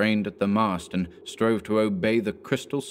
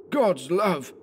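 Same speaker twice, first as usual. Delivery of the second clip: higher, stunned gasp of words; shocked exclamation; 10 semitones higher than usual. A narrator's reading. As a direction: Anderson recoiling at the sight. Anderson shocked.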